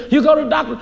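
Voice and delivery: ordinary talk, nothing marked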